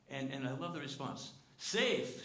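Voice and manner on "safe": high, loud exclamation of the word; booming Mister Beaver. Retold or imitated. Mister Beaver booming the word back at Lucy. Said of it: "said Mister Beaver".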